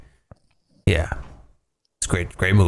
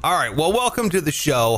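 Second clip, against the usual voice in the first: usual speaking voice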